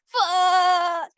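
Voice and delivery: put-on high drawn-out little-kid yell of fuck; mock child yelling fuck. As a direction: shout it high like the small boy going down the slide. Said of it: "the little kid would go".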